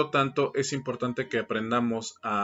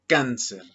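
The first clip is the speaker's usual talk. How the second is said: cáncer said louder, first syllable CÁN hit, falling; teacher dictation of cáncer, CÁN stressed; 4 dB louder than usual. Shown as a demonstration. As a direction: model the word cáncer, CÁN-cer. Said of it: "la palabra".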